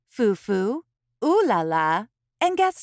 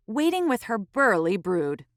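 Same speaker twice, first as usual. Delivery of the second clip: ordinary delivery, nothing marked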